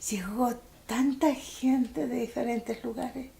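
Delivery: slow, breathless whisper. Real, not acted. Remembering the crowd at her father's funeral, whispered slowly. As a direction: let it fall to a hushed whisper, slowly.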